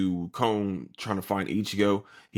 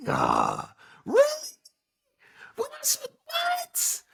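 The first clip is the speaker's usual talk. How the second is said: mock disbelief; much slower than usual. Rukia reacts to what Ichigo said, squeaky mock disbelief. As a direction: squeak out hammed-up shock in a very high voice.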